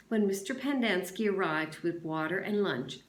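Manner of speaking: ordinary delivery, nothing marked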